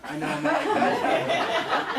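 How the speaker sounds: high, slow laughing whine of doom; mock dread. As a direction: ham up the certainty of getting bitten. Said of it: "I'm like".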